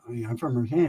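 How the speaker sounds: low, soft mumbled answer; softly mumbled "I mean, I'm from Merced."; quiet mumble. Retold or imitated. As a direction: say it under your breath, hoping it slips by. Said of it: "I didn't hear that, ese".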